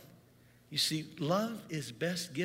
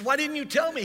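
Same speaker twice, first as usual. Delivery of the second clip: exasperated; 12 semitones higher, 8 dB louder than usual. Retold or imitated. Exasperated retort under the car.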